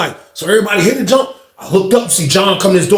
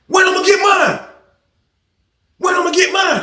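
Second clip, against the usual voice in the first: angry shout; 13 semitones higher than usual. Retold or imitated. Inmate demanding his money.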